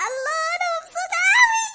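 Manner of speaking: delighted squeal. Acted. Mona squealing with joy at her mango.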